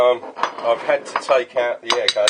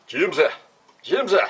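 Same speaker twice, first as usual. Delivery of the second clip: calling out; 8 semitones higher than usual. Real, not acted. Calling his mate Jimsy across the workshop, raised call. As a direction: call the name out higher, rising on the second.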